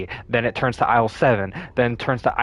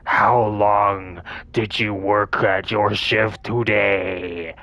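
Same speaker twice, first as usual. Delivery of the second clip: slow, low, looming question; menacing manager; 4 semitones lower, much slower than usual. Retold or imitated. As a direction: loom over them and draw it out. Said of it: "he turns and he says".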